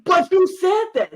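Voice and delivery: high, loud protest; incredulous. Retold or imitated. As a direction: push back in high disbelief. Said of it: "he was like".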